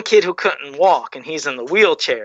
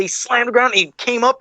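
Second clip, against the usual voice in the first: ordinary talk, nothing marked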